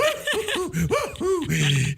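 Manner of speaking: high bouncy cartoon laugh; Tigger laugh. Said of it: "avec la voix de Tigrou".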